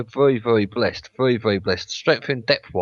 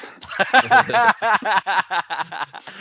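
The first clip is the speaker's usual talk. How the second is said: loud laugh; 9 semitones higher, 4 dB louder than usual. Real, not acted. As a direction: burst of high laughter. Laughing at the impression.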